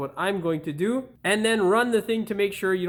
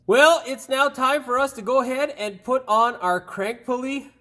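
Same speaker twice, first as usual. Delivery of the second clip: bright, loud presenter voice, higher; upbeat host read; 7 semitones higher, 5 dB louder than usual. Acted. Channel host pitching subscribe to viewers, upbeat presenter read.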